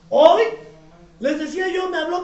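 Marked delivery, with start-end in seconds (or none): 0.1-0.4 one word belted out; abrupt preacher's shout; sudden loud call. Real, not acted. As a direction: punch out the opening word at full volume. Preacher bursts into a new story.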